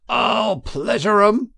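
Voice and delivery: high, loud, slow threat; furious Toad. A narrator's reading. Toad threatening the weasels, high, loud and slow. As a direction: spit the threat out. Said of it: "he yelled".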